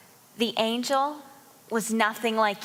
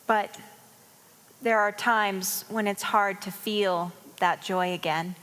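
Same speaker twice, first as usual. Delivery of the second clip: ordinary delivery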